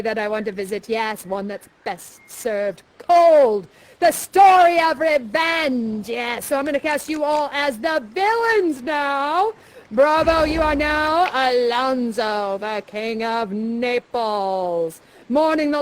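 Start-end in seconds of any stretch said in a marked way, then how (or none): 0.9-6.1 slow, loud, ominous announcement. Acted. Dramatic turn to revenge. 10.4-15.0 drawn out. Acted. Casting with dramatic drawl.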